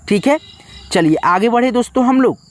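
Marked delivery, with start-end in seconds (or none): none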